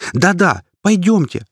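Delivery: high, loud wailing cry. A narrator's reading. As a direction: cry out in a hurry. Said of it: "возопил тот".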